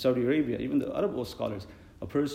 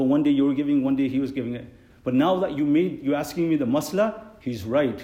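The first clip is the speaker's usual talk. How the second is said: louder, emphatic telling-off; firm retort; 2 semitones higher, 7 dB louder than usual. Retold or imitated. Imam answering the upset muazzin, loud and firm. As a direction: lay down the ruling firmly. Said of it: "I go".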